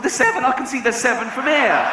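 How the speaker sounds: high, exasperated exclamation. Retold or imitated. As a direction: burst out in disbelief. Frustrated at Douglas.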